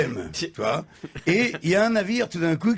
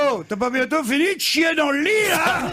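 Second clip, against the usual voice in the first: wife waking up furious at her husband; angry yell; 9 semitones higher than usual. Acted. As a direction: yell at him for soiling the bed. Wife yelling at husband in bed.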